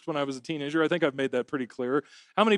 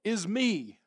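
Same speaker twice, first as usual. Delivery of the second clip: crying out; 6 semitones higher, 4 dB louder, much slower than usual. Acted. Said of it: "he cries out".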